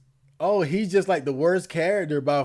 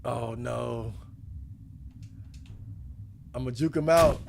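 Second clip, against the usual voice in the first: usual speaking voice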